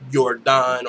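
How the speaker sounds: Jordan said as Yor-DAHN; mispronounced name. Retold or imitated. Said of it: "if someone called me".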